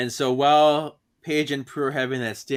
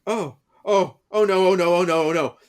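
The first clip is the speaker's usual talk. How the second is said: mock panic; 5 semitones higher, 7 dB louder than usual. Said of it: "they're like".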